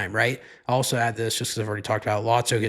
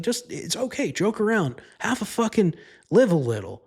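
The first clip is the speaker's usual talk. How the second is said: pitched way up, pleading to lighten up; exasperated urging; 9 semitones higher than usual. Real, not acted. Telling Disney to relax, high excited plea. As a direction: egg them on, high and worked up.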